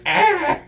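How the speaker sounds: sudden high mock yelp; startled yelp. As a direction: imitate the cop's startled cry. Mimicking startled cop.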